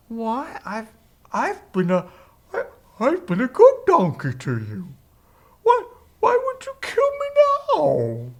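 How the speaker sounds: high, wounded donkey voice; hammed-up, plaintive animal voice; talking donkey. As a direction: plead like a hurt donkey, leaping very high. Donkey talks back, hurt.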